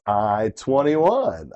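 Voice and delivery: slow drawn-out number call; bingo caller. Acted. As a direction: announce the number slowly, bingo-hall style.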